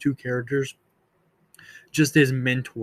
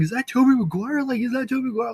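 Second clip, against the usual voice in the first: higher, excited retold shout of recognition; excited mock shout; 10 semitones higher, faster than usual. Fan in a theater retelling a shout at the screen, high and excited. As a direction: call it out like spotting him on screen. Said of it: "I'm over here shouting".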